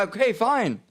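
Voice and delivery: high, fed-up concession; exasperated giving in. Retold or imitated. To the man insisting on watching, high fed-up surrender. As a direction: throw up your hands and agree.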